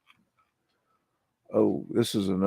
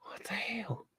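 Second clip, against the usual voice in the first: whispered; 9 semitones higher, 16 dB quieter than usual. Real, not acted. Creeped out by edited photo.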